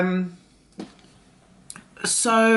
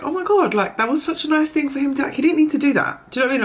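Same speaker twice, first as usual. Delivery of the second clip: much higher, bright overjoyed gush; gushing delight; 8 semitones higher, faster than usual. Retold or imitated. Mother gushing to her daughter about the free snack, thrilled. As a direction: squeal it out like you can't believe the kindness.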